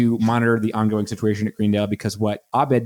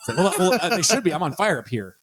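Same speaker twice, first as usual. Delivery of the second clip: mock-flustered high cover-up, laughing; flustered [name]; 7 semitones higher than usual. [name] caught on the mic, covering in a lightly high fluster. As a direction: bluster through being caught.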